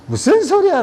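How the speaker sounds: high, loud, stunned disbelief; shocked outburst. Acted. A friend, offered his wife, cries out in shocked disbelief.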